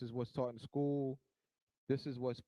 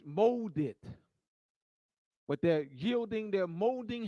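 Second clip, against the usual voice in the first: rising into a louder, forceful sermon; preaching; 3 semitones higher, 5 dB louder, slower than usual. Acted. Lecturer warns the audience their minds are molded, preaching. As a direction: press the point like a preacher warming up.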